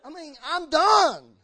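slow, loud, high declaration of being finished; God bellows he has had enough; fed-up God shouting. Retold or imitated. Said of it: "God's gonna say".

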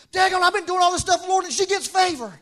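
high, loud, whiny outburst; mock jealous gripe. Speaker griping to the Lord about a rival's favor, jealous. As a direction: complain to God about unfairness.

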